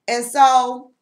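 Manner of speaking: ordinary delivery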